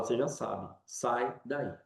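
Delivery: Jesus telling her gently to come out; quiet voice of Jesus. Acted. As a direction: speak as Jesus, softly reassuring. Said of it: "E Jesus fala".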